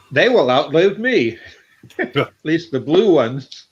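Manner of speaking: laughing through the words; chuckling at his own joke. Real, not acted. Joking about buying towels by the case.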